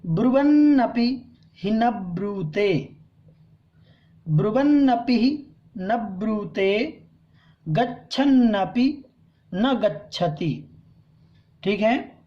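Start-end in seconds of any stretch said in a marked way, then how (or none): 0.1-7.0 measured Sanskrit recitation, each word clear; careful recitation. Shown as a demonstration. Teacher models the verse line for students. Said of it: "ध्यान से पढ़ेंगे". 7.7-10.8 गच्छन्नपि न गच्छति drawn out, with stress on न; slow recitation. Shown as a demonstration. Teacher models the verse for students to repeat. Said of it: "उच्चारण करते हैं".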